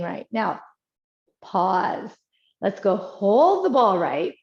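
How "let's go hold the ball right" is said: ordinary talk, nothing marked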